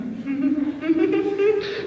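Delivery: squeaky high giggle; high laugh. Acted. After mocking imitation.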